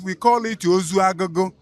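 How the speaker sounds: normal talking voice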